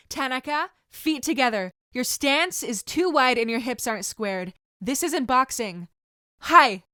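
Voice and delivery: loud correction. Acted. Sensei shouting corrections at Tanaka during drills, loud. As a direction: yell it across the dojo.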